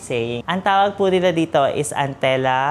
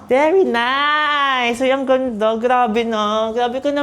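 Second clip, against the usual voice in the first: gushing delight; 6 semitones higher, slower than usual. Real, not acted. Admiring new chairs.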